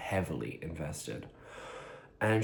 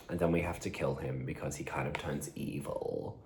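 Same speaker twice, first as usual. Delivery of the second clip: whisper; 5 semitones lower, 8 dB quieter than usual. Real, not acted. Spoiler dropped in hushed voice.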